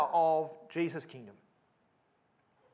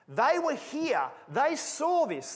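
urgent insistence; 10 semitones higher, 4 dB louder, much slower than usual. Acted. Mark urging readers, eyewitnesses.

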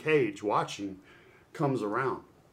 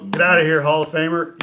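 louder and higher, teasing disbelief; loud playful retort; 7 semitones higher, 18 dB louder than usual. Retold or imitated. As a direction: throw it back at him, laughing it off. Repeats retort to Brett, laughing.

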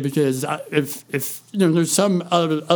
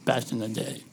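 whispering; 4 semitones lower, 8 dB quieter than usual. Real, not acted. Trails to whisper.

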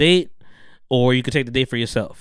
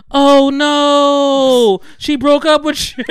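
mocking; 12 semitones higher, 7 dB louder, much slower than usual. Housemates mocking the dumped guy.

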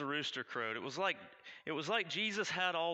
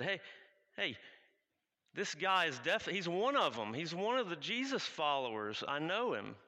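loud, higher, pointing him out to everyone; accusing call; 5 semitones higher, 4 dB louder than usual. Acted. Man in the courtyard accusing Peter, loud and sure. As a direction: call out the stranger for the crowd to hear.

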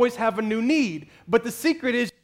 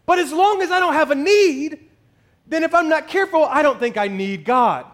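louder, higher, building preacher's cry; preaching climax, rising to a shout on God; full-force preaching; 6 semitones higher, 4 dB louder than usual. Acted. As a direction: drive it home to the congregation.

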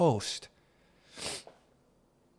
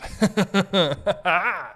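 giggling laugh; 6 semitones higher than usual. Real, not acted.

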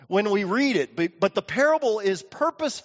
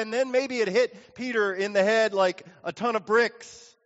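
ordinary delivery

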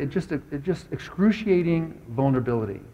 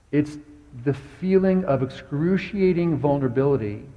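ordinary delivery

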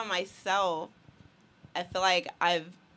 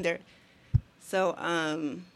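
normal talking voice

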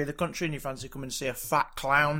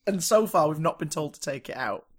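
ordinary talk, nothing marked

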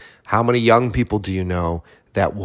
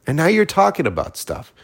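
ordinary delivery

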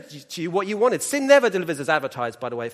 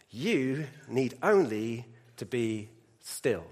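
quiet it down and let each promise settle; calm assurance; 5 semitones lower, 5 dB quieter than usual. Retold or imitated. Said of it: "but goes this".

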